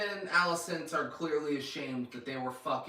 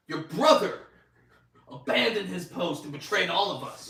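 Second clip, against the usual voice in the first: loud, raised-pitch mock accusation; accusing; 4 semitones higher, 6 dB louder than usual. Commander blaming the brother for betrayal, accusing. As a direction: act out a stern denunciation. Acted accusation in retold quarrel.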